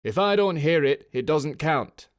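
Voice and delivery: loud call against howling wind; shouting over the gale. A narrator's reading. Officer shouting to his sergeant over the wind, loud. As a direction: yell it over the storm.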